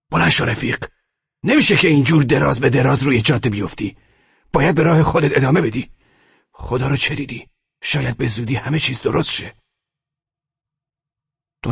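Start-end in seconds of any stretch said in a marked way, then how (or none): 0.1-9.5 higher and louder, urging the prisoner up; driver's voice. A narrator's reading. Driver urges the fallen prisoner to keep walking, higher and louder. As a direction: coax him to his feet, rough and kind.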